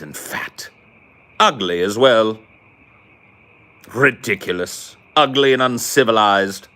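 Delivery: mock British contempt, swooping high; haughty Brit. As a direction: sneer in a hammed-up British accent. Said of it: "That same dude would be like".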